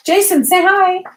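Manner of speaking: coaxing call. Real, not acted. Coaxing child to greet.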